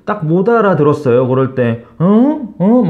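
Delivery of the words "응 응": mock confused huh. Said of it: "대충 막 알아들은 척 하시는 분도".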